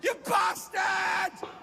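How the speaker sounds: furious yell. Retold or imitated. Wife screaming at the comic from the fourth row, slow furious yell. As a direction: scream it at him slowly through tears. Crying wife yells at comedian.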